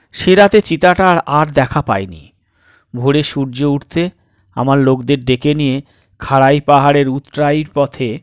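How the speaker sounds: ordinary delivery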